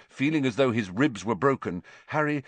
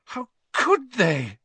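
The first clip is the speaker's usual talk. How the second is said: higher, slow, drawn-out wail of disbelief; anguished cry; 14 semitones higher, slower than usual. A narrator's reading. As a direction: let the horror break out in the voice. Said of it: "she choked".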